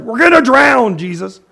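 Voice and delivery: mock panic, we're gonna drown; panicked disciples. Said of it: "It's like".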